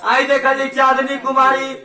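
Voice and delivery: loud, booming stage announcement; mock stage emcee calling out names; stage announcer. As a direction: announce the prize winners to the crowd. Stage prize announcement over music.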